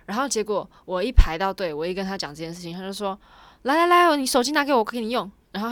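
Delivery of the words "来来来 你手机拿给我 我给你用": high, loud, impatient clerk voice; pushy clerk. Retold or imitated. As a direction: mimic a pushy counter worker. Rude counter clerk retold.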